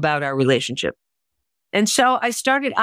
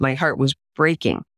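usual speaking voice

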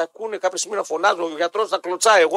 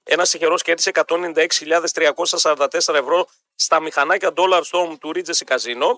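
lower-pitched, steady promo delivery; radio ad read; 3 semitones lower than usual. Acted. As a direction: sell it like a live radio sponsor read. Host reads casino and shop ads.

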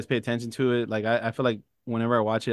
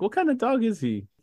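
high character voice; 11 semitones higher than usual. Retold or imitated. Acting movie dialogue.

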